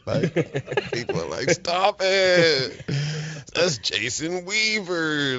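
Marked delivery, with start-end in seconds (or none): 1.5-5.4 higher, slow, awed correction; impressed bystanders. Retold or imitated. Bystanders scolding the crowd, slow and awed. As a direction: tell them off, awed. People voicing awe at his name.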